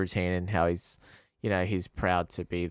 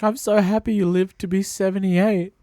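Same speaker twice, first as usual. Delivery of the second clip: high, louder gushing imitation; mock emotional gush; 12 semitones higher, 7 dB louder than usual. Imitating [name] gushing to old [name], high. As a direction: gush like a fan meeting his idol. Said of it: "he's like".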